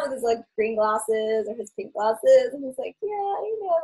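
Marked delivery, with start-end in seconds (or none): 3.0-3.8 high, mellow, easygoing imitation; super-chilled mock voice, pitched up; Skull Teddy. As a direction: let it float out lazily. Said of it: "like, super mellow".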